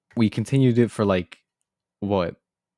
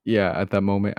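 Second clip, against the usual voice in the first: ordinary talk, nothing marked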